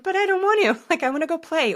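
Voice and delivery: put-on high, pouty kid protest; whiny kid voice. Host voicing the inner kid refusing chores, whiny. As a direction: whine like a child dodging chores. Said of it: "It could sound like a kid".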